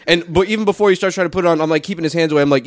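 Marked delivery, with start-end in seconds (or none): none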